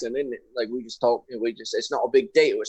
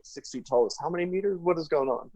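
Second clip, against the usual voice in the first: higher, softer baffled listener; mock confusion; 5 semitones higher, 5 dB quieter than usual. Host imitating Ian converting feet to meters, mock confusion. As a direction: puzzle over it in a high, soft voice. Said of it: "and you're like".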